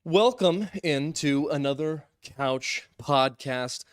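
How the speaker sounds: slow, loud show-host welcome; announcer intro. Acted. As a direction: belt out the opening like a host. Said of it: "I'm gonna say that every time now".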